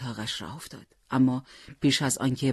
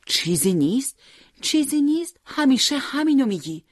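incredulous protest, much higher; mother protesting, pitched high and louder; 11 semitones higher, 4 dB louder than usual. Acted. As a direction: throw his words back at him in disbelief. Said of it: "مادر معترض گفت".

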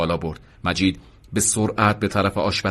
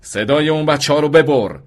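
higher, raised-voice order; shouted command; 6 semitones higher, 4 dB louder than usual. A narrator's reading. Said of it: "فریاد کشید". (فریادِ تند و آمرانه)

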